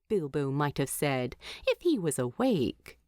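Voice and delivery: ordinary delivery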